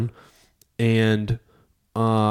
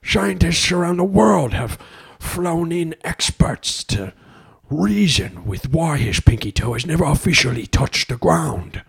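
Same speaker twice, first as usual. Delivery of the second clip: slow, higher Michael Caine-ish narrator; documentary voice; 6 semitones higher than usual. Pretend. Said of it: "That's my documentary voice".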